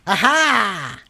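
loud, triumphant exclamations falling in pitch; mock French taunt. Streamer taunting a French player, loud mock greeting. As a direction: crow it at the enemy.

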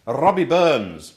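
Robbie Burns with a rolled R, loud and slow; Scottish accent. Shown as a demonstration. Teacher showing the class a Scottish R. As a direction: roll the R in Robbie like a Scot. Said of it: "I can actually do the r when I think of Scottish people".

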